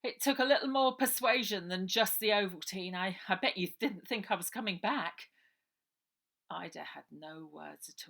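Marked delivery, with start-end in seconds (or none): 0.0-5.3 put-on louder, a bit higher cocky boy; pleased with yourself, a stammer in; Harry proudly telling Ida how he got back.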